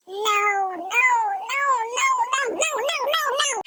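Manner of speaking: put-on high, soft childish whine of no; whiny refusal. [name] refusing to perform, high childish whine. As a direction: whimper no like a sulking child. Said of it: "And he's like".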